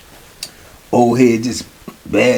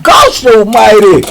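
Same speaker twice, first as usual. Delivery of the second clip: higher, slow, drawn out; stunned; 7 semitones higher, 5 dB louder, slower than usual. Retold or imitated. Teller reacting to the attack, stunned and slow. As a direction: let it out in disbelief. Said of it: "I said".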